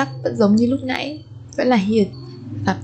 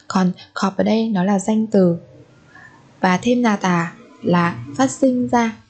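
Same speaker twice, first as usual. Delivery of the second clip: ordinary delivery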